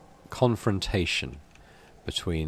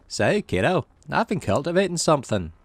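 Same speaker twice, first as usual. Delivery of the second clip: a light, raised cheerful dad; cheery dad voice; 7 semitones higher, 5 dB louder than usual. Acted. Dad greeting his kid with a new plant, lightly raised cheery voice. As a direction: read the dad a touch higher, friendly. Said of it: "he says".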